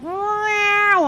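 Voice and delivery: high, drawn-out meow; imitated cat yowl. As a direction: wail like a cornered cat. Imitates the cornered cat's yowl.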